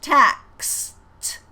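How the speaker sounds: "Taxed" said loudly with a sharp K and S before the T; loud clear teacher demo of "Taxed"; stressed KS. Shown as a demonstration. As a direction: bite the KS in "Taxed". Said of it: "we have to hear the K and the S".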